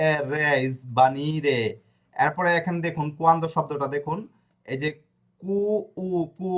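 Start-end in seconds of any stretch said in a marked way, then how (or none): 0.1-1.6 এ, রে, ইসবানি রে spelled out very slowly; slow spelling out. Shown as a demonstration. Teacher spelling a Spanish word syllable by syllable, very slow. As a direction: drag each syllable of ইসবানি রে. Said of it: "এরপরে আর এ".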